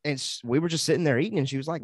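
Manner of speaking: normal talking voice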